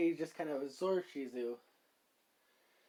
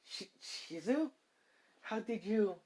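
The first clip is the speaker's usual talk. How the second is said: stammered, higher-pitched disbelief; wide-eyed shock; 6 semitones higher than usual. Acted. Issei suddenly hearing Shizu's voice inside him, stunned. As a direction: stretch the name out slowly in astonishment. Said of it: "eyes wide and saying".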